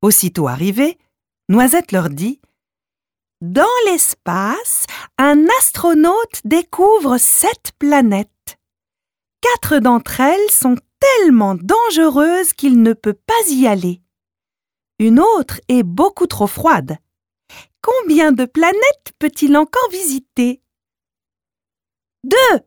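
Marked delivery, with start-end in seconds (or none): 3.4-20.6 riddling squirrel. A narrator's reading. Noisette poses a riddle. 22.2-22.6 high excited shout of an answer. A narrator's reading. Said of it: "crie Malika".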